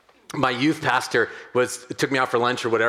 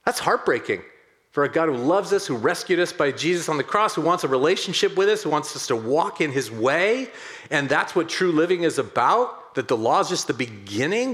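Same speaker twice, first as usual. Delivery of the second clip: ordinary talk, nothing marked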